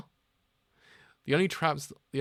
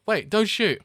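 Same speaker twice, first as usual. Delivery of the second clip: panicked cry; 10 semitones higher, 10 dB louder, slower than usual. Acted. Character begs not to shoot.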